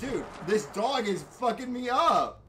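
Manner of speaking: high, loud, slow drawn-out yell; desperate yell. Real, not acted. Gamer getting mauled, desperate loud yell. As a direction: wail it out, overwhelmed. Overwhelmed by dog attack.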